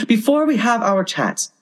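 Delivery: high-pitched oyster plea, easing down at the end; small creatures protesting in high voices; oysters crying out. A narrator's reading. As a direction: pipe up high to stall them. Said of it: "the oysters cried".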